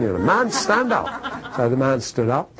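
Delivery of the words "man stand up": brusque order, high-pitched, with amusement; mock gruff command to get up; gruff German. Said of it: "not the way to begin a counseling session".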